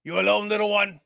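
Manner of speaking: big, loud captain sizing up a newcomer; orc captain. Acted. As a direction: call out a challenge to a small stranger. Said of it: "starts walking towards you and goes".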